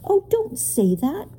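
high, drawn-out plea; desperate. A narrator's reading. Ben begs the proc to relent, desperately. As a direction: plead desperately, pitch jumping up. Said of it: "Ben said desperately".

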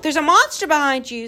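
high, urgent creature scream; screamed warning. A narrator's reading. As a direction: shriek it to trick them into turning. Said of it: "screamed the gaboons".

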